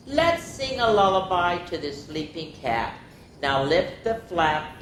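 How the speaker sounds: plain reading voice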